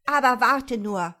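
loud, high threat; angry scolding. A narrator's reading. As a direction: threaten the cat crossly. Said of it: "zankte Marie weiter".

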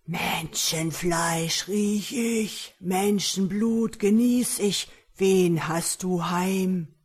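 slow, loud ogre roar; shouting man-eater. A narrator's reading. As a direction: draw out each word like a hungry ogre. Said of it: "schrie er". (langsam brüllender Menschenfresser)

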